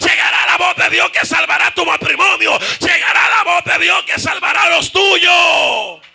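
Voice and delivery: loud, high-pitched revival shout; full-force preaching. Real, not acted. Preacher promises congregation God's saving voice, shouted at full force.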